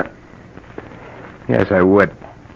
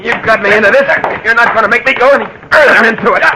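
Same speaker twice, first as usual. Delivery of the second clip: desperate anger; 10 semitones higher than usual. Acted. Struggle over a gun.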